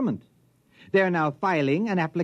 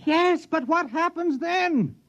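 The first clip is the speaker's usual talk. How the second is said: much higher and slow, straining with worry; anxious demand; slower than usual. Acted. Man insisting the scientist explain the collapse, anxious. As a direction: drag the answer out of him, scared.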